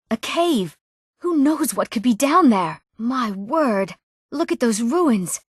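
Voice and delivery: high, wonder-struck exclamations; awed excitement. Acted. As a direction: marvel aloud at a thrilling discovery. Exclaiming at discoveries while exploring.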